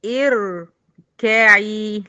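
اركعي begun with i, irkaʕi, drawn out; starting with kasra. Shown as a demonstration. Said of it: "هقول". (ابتداء اركعي بهمزة مكسورة)

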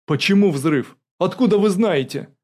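loud, rising-pitched bewilderment; stunned. A narrator's reading. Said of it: "Оторопевший офицер". (оторопело, на повышенных тонах)

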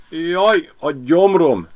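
dog groaning at the thought of eating a rat; moaning dog. A narrator's reading. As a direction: moan it like a sick stomach. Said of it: "nyögte Miska".

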